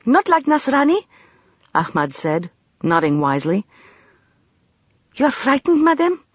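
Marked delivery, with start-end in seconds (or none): 0.1-1.0 put-on child voice. A narrator's reading. Ahmad child voice. 5.2-6.2 put-on child voice. A narrator's reading. Ahmad child voice.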